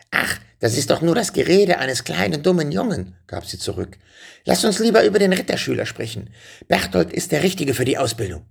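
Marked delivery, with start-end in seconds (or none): 0.1-3.1 high-pitched witch brushing it off; dismissive witch. A narrator's reading. Witch belittling the boy to the king, dismissive. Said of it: "die Hexe winkte ab". 4.4-8.5 high witch voice, starting fast; witch's voice. A narrator's reading. Witch steering the king to Berthold, high and brisk.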